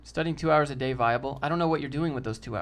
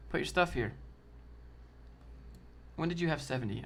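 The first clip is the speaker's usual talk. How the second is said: usual speaking voice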